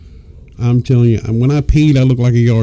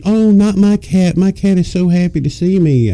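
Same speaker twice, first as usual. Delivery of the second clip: put-on doting girlfriend; 6 semitones higher than usual.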